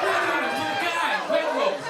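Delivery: loud outraged exclamation. Retold or imitated. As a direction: shout it in disbelief. Outraged at bread rolls.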